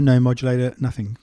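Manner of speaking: normal talking voice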